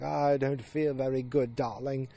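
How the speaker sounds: slow, lightly put-on husband voice; a hint of a reluctant husband; husband's voice. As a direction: imitate a husband begging off, slowly. Voices husband answering his wife.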